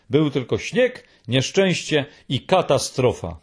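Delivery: usual speaking voice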